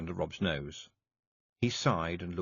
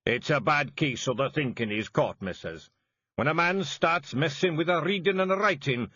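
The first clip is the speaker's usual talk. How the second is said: loud Scottish pixie, matter-of-fact; gruff Big Yan; 7 semitones higher, 4 dB louder than usual. A narrator's reading. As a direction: warrior explaining the thinking.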